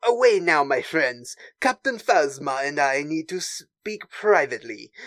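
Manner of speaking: French-accented cartoon suitor dismissing company. Agent Terex sending his friends away, French accent. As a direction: shoo them off in a Pepe Le Pew French accent. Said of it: "that is the voice".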